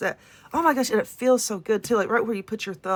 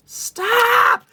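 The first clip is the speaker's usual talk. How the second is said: startled cry; 12 semitones higher, 5 dB louder than usual. Real, not acted. Dropped item in lap.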